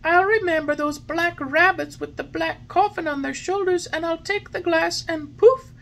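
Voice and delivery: put-on high, loud happy boy voice; cheerful puppet. A narrator's reading. Puppet cheering to the fairy, full of life. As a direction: bounce with new life.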